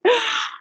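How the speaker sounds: high sobbing gasp; mock-retold crying gasp; sharp gasp. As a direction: gasp through tears. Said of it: "And I was like".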